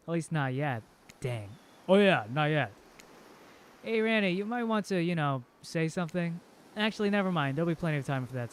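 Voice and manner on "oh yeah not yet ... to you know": usual speaking voice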